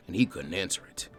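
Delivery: usual speaking voice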